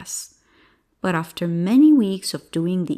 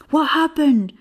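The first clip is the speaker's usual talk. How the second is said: higher, louder alarmed call; shouted question; 8 semitones higher, 5 dB louder than usual. Acted. Farmer racing up to help the boy, shouting. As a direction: call it out urgently. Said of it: "one farmer shouted".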